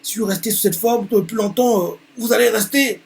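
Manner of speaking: high pleading voice; secretary begging her boss in a high voice. Acted. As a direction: beg the manager to change back. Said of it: "elle continue en disant".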